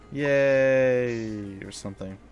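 drawn-out yay, dropping away; half-hearted cheer. Acted. Player reacting to father's nicknames, unenthusiastic cheer.

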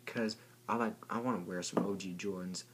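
usual speaking voice

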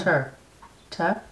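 Ter tried as "tuh"; attempted British ending. Shown as a demonstration. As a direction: copy Ter with a crisp t. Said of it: "say it like you say it".

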